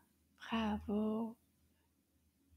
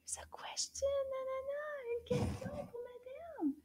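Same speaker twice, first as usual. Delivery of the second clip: whisper; 13 semitones higher, 9 dB quieter than usual. Pretend. Puppet whispers to teacher.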